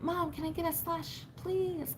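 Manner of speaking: soft, high mock begging; imitating pleading kids. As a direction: ask sweetly like a little kid. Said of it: "my littles were like".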